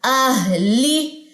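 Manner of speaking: अहली very slow, both syllables stretched; measured, sustained अहली; recitation demo. Shown as a demonstration. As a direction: draw out the H and long ली of अहली. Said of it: "लाम का मखरज क्या है".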